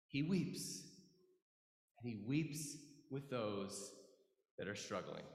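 low, soft and solemn; hushed compassion. Real, not acted. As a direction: let the voice sink gently, with pauses. Preacher on Jesus weeping with sufferers.

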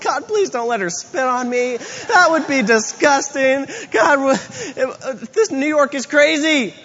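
high-pitched, flustered mock plea; frantic prayer. Man praying she won't spit on him, comically frantic. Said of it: "I'm just praying".

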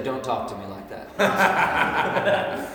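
slow, laughing mock of a child throwing it back; cheeky child. Pastor imitating his kid talking back, slow and laughing. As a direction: parrot the parent's line like a sassy kid. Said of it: "they would look at me and say".

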